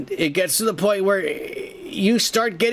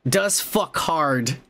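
slow, stretched; slow savoring delivery; slow emphatic; much slower than usual. Retold or imitated. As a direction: drag out does fuck hard. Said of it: "Deadpool says".

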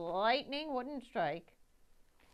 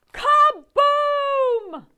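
very high, very loud burst; big kaboom; 22 dB louder than usual. A narrator's reading. Narrator bursting out with the explosion sound, very loud. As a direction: explode the word.

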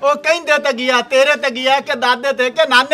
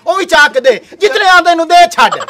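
higher, louder call into the house; 7 semitones higher, 7 dB louder than usual. Acted. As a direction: shout it to the wife inside. Calling wife to bring money. (ਉੱਚੀ ਆਵਾਜ਼ ਵਿੱਚ ਘਰਵਾਲੀ ਨੂੰ ਪੁਕਾਰਨਾ)

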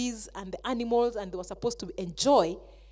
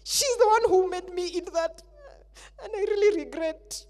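higher, pleading, blaming voice; whining excuse; 8 semitones higher, 5 dB louder than usual. Acted. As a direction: shift the blame, then start to weep. Adam blaming Eve to God.

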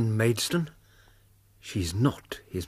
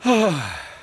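a loud high sigh of relief; 7 semitones higher, 4 dB louder than usual. Acted. Watson stepping out of the smoke, relieved sigh. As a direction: let the breath out, glad to be out. Watson escapes the smoky room.

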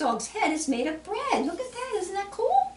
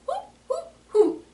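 high, hollow owl hoots; owl hooting. Retold or imitated. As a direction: hoot like an owl for children. Voices the owl in picture book.

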